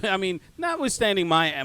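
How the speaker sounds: ordinary talk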